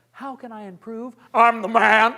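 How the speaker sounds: humble question, then a loud boast; two extremes. Shown as a demonstration. Coach shows humble then arrogant pitch. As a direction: swing from meek to cocky. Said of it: "there's two extremes there".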